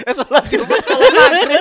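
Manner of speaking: high, loud laughing correction. Real, not acted. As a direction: laugh through the slip. Laughing at own slip.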